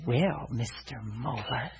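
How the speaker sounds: low, slow, soft cartoon villain; sinister nurse. Nurse back with the x-ray, sinister. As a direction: draw it out with quiet menace. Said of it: "she looked to me like sinister".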